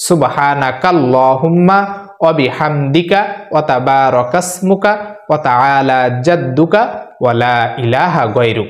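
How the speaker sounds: slow, syllable by syllable Arabic prayer recitation; slow careful recitation. Shown as a demonstration. Teacher repeating Sana for viewers to memorize, slow. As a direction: recite সুবহানাকাল্লাহুম্মা slowly and clearly. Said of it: "আমি আবারও বলছি".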